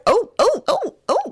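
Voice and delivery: very high breathy "oh"s, shocked fan; high flustered gasps. Retold or imitated. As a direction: gasp out each oh in a high voice. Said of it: "It's like".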